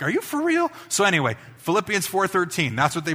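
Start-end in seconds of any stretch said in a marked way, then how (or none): 0.0-0.7 much higher, disbelieving; retold shocked "Are you for real?"; incredulous. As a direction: jump up high in disbelief. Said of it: "I'm like".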